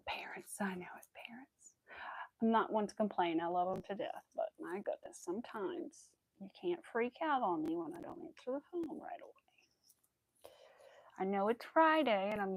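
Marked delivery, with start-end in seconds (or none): 0.1-1.7 soft, whispered sigh of a remark; resigned whisper. Real, not acted. Grumbling about parents, softly whispering. 5.2-9.3 soft whispered gripe; whispered complaint. Real, not acted. Complaining about her mother freaking out, whispering.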